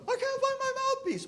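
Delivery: very high, whining student; mock whine of a lost mouthpiece; whiny kid voice. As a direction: squeak it like a helpless kid. Imitates the student's complaint.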